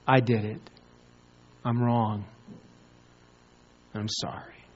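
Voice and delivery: low and soft, fading almost to nothing; quiet confession. Acted. A believer confessing to God, hushed and broken.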